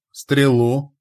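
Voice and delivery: high, loud one-word bark; roared command. A narrator's reading. Митяй demands an arrow while winding the winch, roaring. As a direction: roar it out as an urgent demand. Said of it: "рявкнул, накручивая ворот, Митяй".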